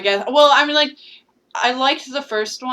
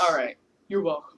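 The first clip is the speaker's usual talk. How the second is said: deep voice; 5 semitones lower, 8 dB quieter than usual. Pretend. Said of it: "I'm trying to get deep".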